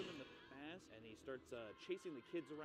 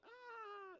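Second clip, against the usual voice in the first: mock scream.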